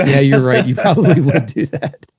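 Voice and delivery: loud, laughing concession; laughing agreement. Retold or imitated. Conceding to dad while laughing loudly. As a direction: give in through laughter. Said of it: "I'm like".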